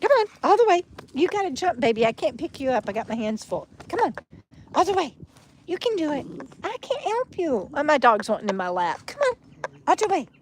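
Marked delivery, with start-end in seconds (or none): 0.0-7.7 high, soft pet-talk coaxing; coaxing the dog. Real, not acted. Owner gently coaxing her dog to jump into her lap. 9.1-10.3 higher, sing-song pet coaxing; coaxing the dog. Real, not acted. Owner coaxing her dog up onto her lap.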